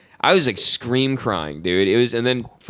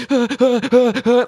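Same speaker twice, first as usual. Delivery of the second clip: imitated high, panicked gasping; mock hyperventilating in short high bursts; panic-attack gasps; 12 semitones higher, 4 dB louder than usual. Retelling himself as a scared kid, panicked gasps. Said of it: "like, having a panic attack".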